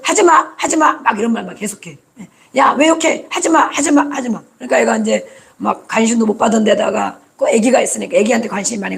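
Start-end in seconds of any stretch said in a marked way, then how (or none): 0.0-0.9 higher, insistent no-no; nagging parent. Retold or imitated. Parent repeating don't to the cursing child, higher. 2.6-4.4 higher, sharp repeated stop it; scolding parent. Retold or imitated. Parent scolding a son for swearing, higher.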